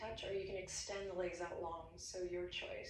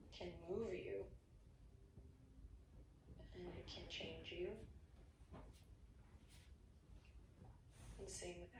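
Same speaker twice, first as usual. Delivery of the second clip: guided meditation; 5 dB quieter than usual. Acted. Teacher guiding class into quiet breathing, soft meditation voice. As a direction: guide them to close their eyes and breathe.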